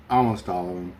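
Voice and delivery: ordinary talk, nothing marked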